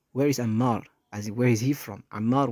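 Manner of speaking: ordinary delivery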